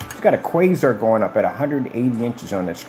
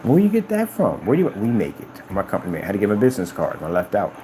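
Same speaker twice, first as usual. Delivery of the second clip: ordinary delivery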